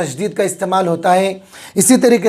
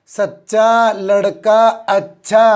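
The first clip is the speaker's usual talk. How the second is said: slow reading; much slower than usual. Shown as a demonstration. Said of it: "क्या पढ़ेंगे इसको".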